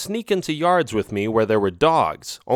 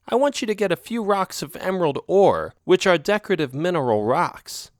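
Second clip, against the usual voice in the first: put-on higher, coaxing young female cat voice; sly girlfriend cat; 7 semitones higher than usual. A narrator's reading. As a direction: sweet-talk him into a favour. Narrator voices Isabel.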